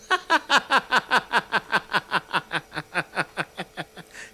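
long, high-pitched run of laughter; sustained high giggling. Acted. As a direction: laugh on and on in quick high pulses. Laughing at what mother wrote.

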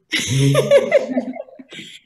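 high breaking laugh, half crying; teary laugh. Real, not acted. Laughing through tears as she remembers her dad's joke.

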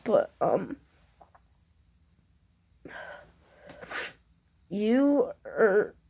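holding back tears. Real, not acted. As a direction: voice strained, fighting back real tears. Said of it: "I'm trying to fight back tears right now".

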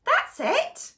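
high, eureka that's it; bright idea. Acted. Reader finding a way to save the book, excited.

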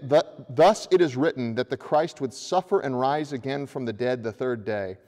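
ordinary talk

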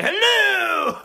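high startled hello; mock startled cry. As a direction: jump as the monocle slips. Monocle drops, startled shout.

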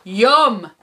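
loud troll "yum" in the reader's own pitch. A narrator's reading. Troll spots the digger, loud greedy relish. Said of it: "says the troll".

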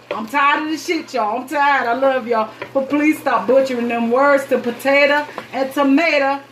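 half-joking complaint pushed up high; fed-up rant. Real, not acted. Cook scolding viewers over word endings, exasperated.

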